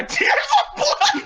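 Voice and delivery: high squeal through laughter; laughing shout. Real, not acted. Reader cracking up at "tears of blood", laughing shout. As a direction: crack up as you yell it. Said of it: "I started to cry".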